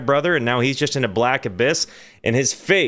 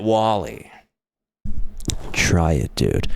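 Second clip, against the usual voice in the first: much lower, mumbled big brother pushing; 10 semitones lower than usual. Retold or imitated. Older brother pressing Wally again, deep and lazy. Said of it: "brother insists again".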